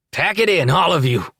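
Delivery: high, loud command; shouted order. A narrator's reading. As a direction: bark it to everyone. Boss ordering men out.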